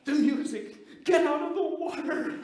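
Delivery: high, loud panicked scream; shrieking warning at the screen; kid screaming. Retold or imitated. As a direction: yell it like a terrified kid in a cinema. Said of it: "he screamed out".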